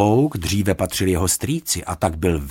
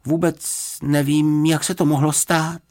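innocent excuse; 6 semitones higher than usual. A narrator's reading. Bear innocently excuses the mess.

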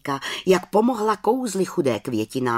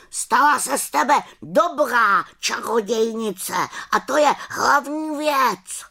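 raven's voice; 6 semitones higher than usual. Acted. Raven's farewell continues.

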